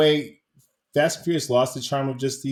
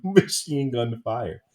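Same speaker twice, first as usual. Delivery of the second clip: normal talking voice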